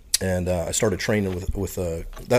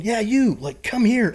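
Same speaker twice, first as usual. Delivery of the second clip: high, raised mock beckoning call; retold holler, pitched up and louder; calling someone over; 13 semitones higher, 5 dB louder, slower than usual. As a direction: imitate a man summoning someone across the room. Imitating official beckoning him over.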